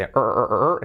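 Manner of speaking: "er" repeated as a strong English R; isolated, repeated R sound, "er, er, er". Shown as a demonstration. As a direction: drill the R hard in "er". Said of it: "con la R bien fuerte".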